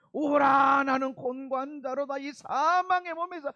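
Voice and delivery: loud anguished crying cry of repentance; wailing lament. Acted. Preacher voicing a sinner's loud wailing lament. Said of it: "하는 그런 통곡이 일어나는 거야".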